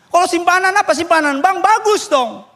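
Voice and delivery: high, punchy feigned puzzlement; mock innocence. Pastor asks the man about his savings, high in mock innocence. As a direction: imitate an innocently puzzled questioner.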